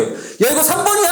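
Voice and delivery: put-on high, insistent cry of a kid sure of the answer; overconfident kid. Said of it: "틀린 애가 막 확실하게 얘기해요". (확신에 찬 높은 목소리로 우기는 아이 흉내)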